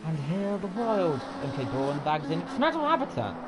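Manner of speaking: hushed wildlife narrator voice; nature documentary. Pretend. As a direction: describe the car like rare wildlife. Wildlife-style description of a car.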